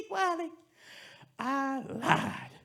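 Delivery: high cackle then gleeful confession; cackling mother. Acted. Mother gloating to Wiley, high cackling confession.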